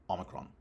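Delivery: Omicron with a short first vowel, AH-muh-kron, said softly; quiet Omicron, short O up front; short initial O. Shown as a demonstration. Contrast with lengthened initial vowel.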